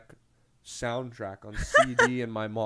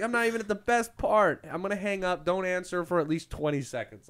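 high, loud indignant whine; mock complaint; 6 semitones higher, 4 dB louder than usual. The caller is annoyed at missing the best part, whining in mock complaint. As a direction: complain like the song got cut off. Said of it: "you're like".

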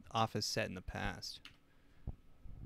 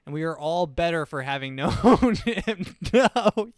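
laughing; 5 semitones higher, 11 dB louder than usual. Real, not acted. Laughs at the eulogy.

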